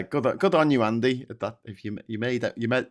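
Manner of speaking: ordinary delivery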